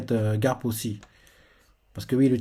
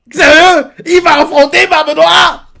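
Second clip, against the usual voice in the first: much higher, much louder amazed yell; excited shout; 12 dB louder, slower than usual. Real, not acted. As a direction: shout in disbelief at the reveal. Reacting to the chapter. (cri d'excitation sincère)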